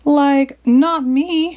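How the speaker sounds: high, mild mock whimper; a touch of cartoon fear, pleading; Shaggy whimpering. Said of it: "Shaggy whimpered".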